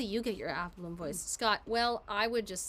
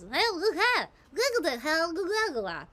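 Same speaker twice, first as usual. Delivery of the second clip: mock Applin gibberish; 7 semitones higher, 7 dB louder than usual. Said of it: "what half of them sounds like to me".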